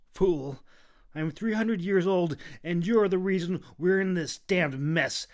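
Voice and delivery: loud, high retort; shooting back. A narrator's reading. Kita shooting back at the Clove's boast. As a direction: snap it back at him. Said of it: "Kita shot back".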